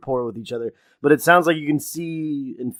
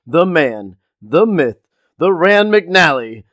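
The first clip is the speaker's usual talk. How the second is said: louder build-up like a ring announcer; mock grand introduction of the man and the myth; hype introduction; 2 semitones higher, 5 dB louder, slower than usual. Co-host hands over to Miles with a mock grand build-up.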